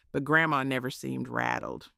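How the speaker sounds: normal talking voice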